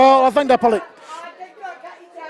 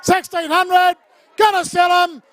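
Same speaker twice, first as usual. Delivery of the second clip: rapid auction chant, calling bids; auctioneer calling; 5 semitones higher than usual. Acted. Runs the charity auction.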